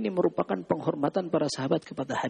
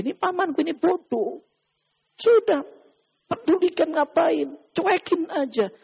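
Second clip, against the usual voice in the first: dismissive; 9 semitones higher than usual. Acted. The nephew tells Umar to ignore his foolish uncle, high and dismissive. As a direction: wave the fool away.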